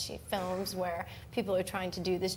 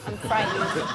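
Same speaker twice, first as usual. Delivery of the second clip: laughing, louder mock fear; half-hearted joking fear, louder; mock fright; 8 dB louder, slower than usual. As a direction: play scared for laughs through a laugh. Dreading the host's dream question.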